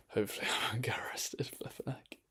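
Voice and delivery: quick whisper with a laugh; nervous whisper. Real, not acted. Vlogger joking to viewers about arrest, whispered. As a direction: hurry it out under your breath, half laughing.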